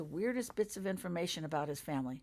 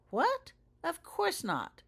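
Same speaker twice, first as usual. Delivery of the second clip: jumps much higher, then slows down; shocked disbelief; 12 semitones higher, 4 dB louder, much slower than usual. Acted. James reacting to Holly's toy shop idea, stunned disbelief. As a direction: caught off guard, then denies it slowly.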